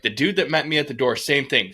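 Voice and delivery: ordinary delivery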